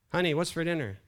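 loud, high call to another room. Acted. Husband to wife in the kitchen, calling out. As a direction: call out to someone out of earshot. Husband calls again, closer.

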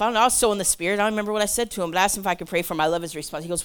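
ordinary talk

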